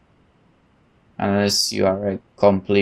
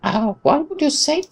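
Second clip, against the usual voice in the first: squeaky scandalized gasp, way up high; high mock gasp. Real, not acted. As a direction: clutch your pearls in falsetto. Player reacting to a barb.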